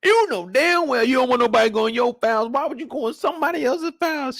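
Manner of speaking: captain yelling at Burke over the files; angry captain. Retold or imitated. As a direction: chew Burke out for snooping. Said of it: "you said".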